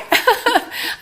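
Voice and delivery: short dry cough burst; a sudden dry cough. Real, not acted. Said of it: "I have a cough coming in".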